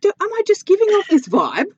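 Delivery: high, loud disbelieving question; mock bafflement. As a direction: play up the confusion. Retold own baffled reply.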